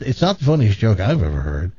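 normal talking voice

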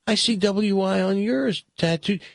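plain American tourist, amused. Acted. Tourist echoes the attendant's line.

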